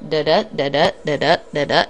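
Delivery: da-da chanted da-DA, weak then strong beat; iambic da-da rhythm. Shown as a demonstration. Teacher demonstrating meter to the class, chanting da-da rhythmically. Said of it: "iambic pentameter, which is the most common form".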